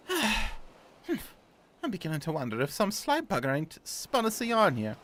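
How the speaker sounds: muddled Cockney-Scottish grumble; wobbly put-on Cockney-Scottish; badly faked accent. Sailor suspecting he was tricked, in muddled Cockney-Scottish. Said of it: "I really gotta figure out what accent I wanna do".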